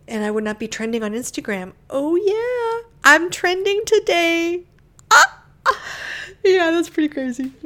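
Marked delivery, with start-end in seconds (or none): 1.9-7.5 high, loud, showing-off delight; excited brag. Real, not acted. Speaker bragging to listeners about trending, high excited squeal. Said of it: "Sorry, don't get arrogant".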